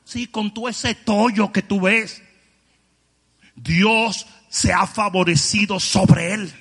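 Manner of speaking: preaching at full force. Acted. Preacher shouting to the congregation, full force. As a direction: thunder it from the pulpit.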